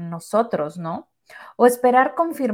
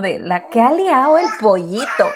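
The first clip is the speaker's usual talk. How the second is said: laughing; 8 dB louder than usual. Retold or imitated. Quoting a funny meme.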